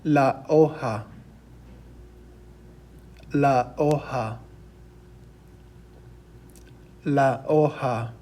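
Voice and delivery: hoja as OH-ha, silent h, slowly; Spanish pronunciation. Shown as a demonstration. Teacher models the word for leaf, slow demonstration. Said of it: "our Spanish word for leaf is".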